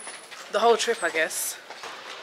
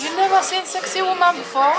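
sassy quip; 9 semitones higher than usual. Pretend. Mocking staring diners.

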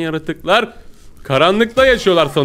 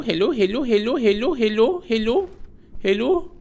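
sing-song hellos; 8 semitones higher, 4 dB quieter than usual. Pretend. Greeting creatures in sing-song.

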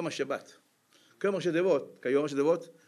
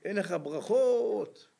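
high, drawn-out wail to a father; plaintive acted howl; mock wailing cry; 5 semitones higher, much slower than usual. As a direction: imitate a wronged son crying out, stretching the last word. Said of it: "צועק".

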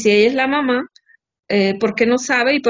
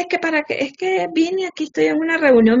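higher, stammering excuses; mock fumbling husband; 7 semitones higher than usual. Wife mimicking husband's flustered excuses, higher. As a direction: imitate a caught man stalling.

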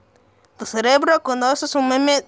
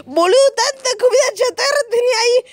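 shocked; 9 semitones higher than usual. Real, not acted. Shocked at the meme.

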